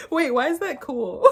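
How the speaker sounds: high, giggly amazement; delighted laughing. Real, not acted. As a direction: burst into laughter as you say it. Laughing, delighted by word meaning.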